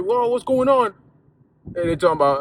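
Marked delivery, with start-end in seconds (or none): 0.0-0.9 alarmed parent. Retold or imitated. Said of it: "So you rush up in there".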